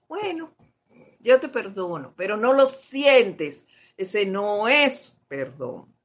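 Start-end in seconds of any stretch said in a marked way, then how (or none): 0.1-2.1 half-hearted forgiveness. Retold or imitated. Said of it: "por decir algo".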